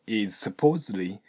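"is supposedly" linked, the S running straight into "supposedly". Shown as a demonstration. Teacher showing students the linking, smooth and joined. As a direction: blend "is" into "supposedly" with no break. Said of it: "こんな感じでくっつけてみましょう".

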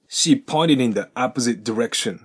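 "pointed in" and "opposite direction" linked; full linked read. Shown as a demonstration. Teacher runs the whole sentence linked. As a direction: read it through with both links. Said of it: "もう一度通して発音すると".

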